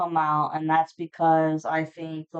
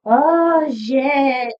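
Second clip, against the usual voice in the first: sudden high, loud "oh shit"; startled exclamation at her own slip; surprised curse; 7 semitones higher, 6 dB louder than usual. Real, not acted. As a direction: blurt it out, caught off guard. Realizes a blunder mid-reading.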